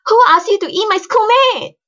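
very high, loud indignant yell; shrill scolding. A narrator's reading. Girl scolding the aunt who nearly ate her schoolmate, shrill. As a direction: tell them off shrilly at full pitch. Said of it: "she said shrilly".

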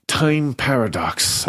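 slow, weary giving-in; resigned. A narrator's reading. Fairy Ada giving up on explaining, slow and resigned. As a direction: let the anger drain into resignation. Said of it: "less angry, more resigned".

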